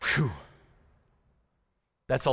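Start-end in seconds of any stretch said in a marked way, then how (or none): 0.0-0.3 voiceless puff of relief; whew. Real, not acted. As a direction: blow out a long breath. Exhales after heavy teaching.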